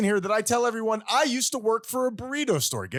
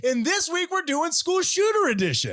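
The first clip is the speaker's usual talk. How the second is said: game show host voice; 9 semitones higher than usual. Acted. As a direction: ham it up as a TV host. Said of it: "ridiculous voices on it".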